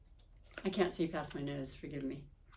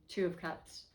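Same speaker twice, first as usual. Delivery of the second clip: ordinary delivery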